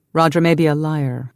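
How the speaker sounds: usual speaking voice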